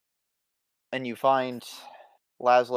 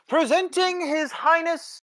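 pompous fussy courtier; 7 semitones higher than usual. Acted. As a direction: announce it grandly like a royal herald. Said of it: "Henry says".

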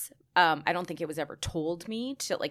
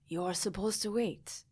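put-on slow, softer French-accented murmur; 6 dB quieter, slower than usual. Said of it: "in his French accent".

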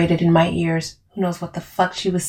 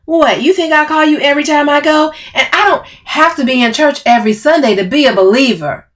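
loud, high, defensive comeback; angry retort; 9 semitones higher, 6 dB louder than usual. Acted. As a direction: argue back heatedly. Camille's snapped retort continues.

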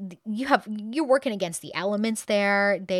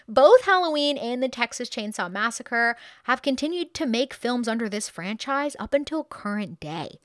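ordinary delivery

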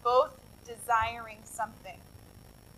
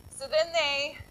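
ordinary delivery

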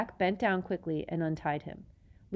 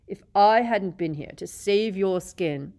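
angry; 3 semitones higher, 8 dB louder than usual. A narrator's reading. Snape angry.

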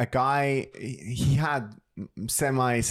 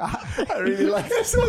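high, giggly praise; laughing delight. Real, not acted. Praising the friend's joke, laughing high. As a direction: gushes through laughter.

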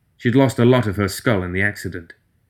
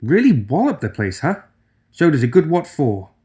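higher, chipper cheering up; Nelly's voice; 6 semitones higher than usual. Acted. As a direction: brighten the mood gently.

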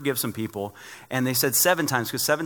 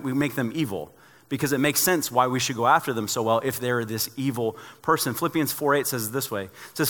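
ordinary delivery

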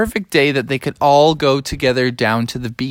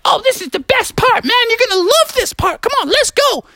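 put-on much higher child voice, eager; excited kids. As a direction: sound like kids who can't wait. Beaver kids excited about dirt road.

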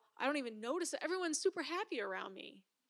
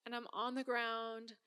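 normal talking voice

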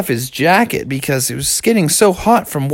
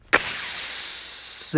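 long hiss of melting ice; sizzling hiss; 4 dB quieter than usual. Acted. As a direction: imitate fire hitting ice. Ice sizzles under dragon fire.

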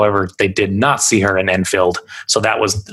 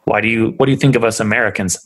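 ordinary talk, nothing marked

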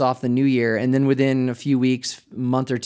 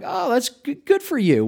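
bright, much higher, overly chipper voice; mock cheery greeting; 11 semitones higher than usual. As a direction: play the eager, too-friendly greeter at the gym. Pastor greets newcomer at gym.

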